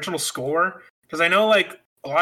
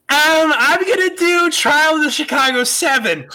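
high, loud put-on voice of a friend; bad Lori impression; 11 semitones higher, 6 dB louder than usual. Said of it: "this is a really bad impression of Lori's voice".

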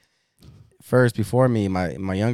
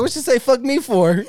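laughing quote; 14 semitones higher, 4 dB louder than usual. Retold or imitated. Quoting self while laughing.

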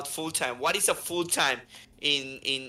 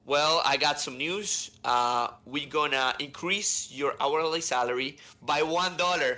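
slow boss announcement; slower than usual. Retold or imitated. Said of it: "he goes like".

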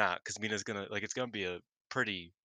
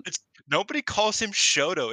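high, loud, baffled protest; exasperated outburst at a friend; incredulous correction; 11 semitones higher, 10 dB louder than usual. Real, not acted. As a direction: object loudly, pitch jumping way up. Correcting co-host's name for character.

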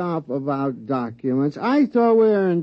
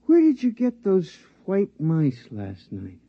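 ordinary talk

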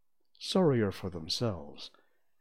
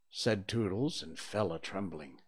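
usual speaking voice

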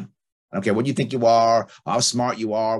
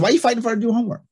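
fast, higher and louder, pressing the question; husband pushing back at the wife over the homework fight, fast and raised; exasperated retort; 10 semitones higher, 5 dB louder, much faster than usual. Retold or imitated. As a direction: fire it back at her, exasperated.

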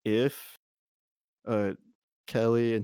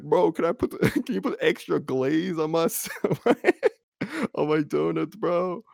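laughing bro voice; 6 semitones higher, 4 dB louder than usual. Retold or imitated. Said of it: "he was like".

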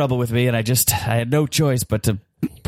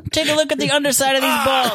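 frantic shouting hostage taker; 10 semitones higher than usual. Acted. Improv hostage taker taunting sniper.

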